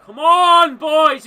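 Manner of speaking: loud, high fan yell; real shout of frustration; shouting at the team. Real, not acted. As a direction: roar encouragement at the players.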